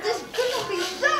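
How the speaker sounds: high protest through a squeezed nose; pinched-nose voice. Acted. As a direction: repeat it with your nose held. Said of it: "You're squeezing my nose".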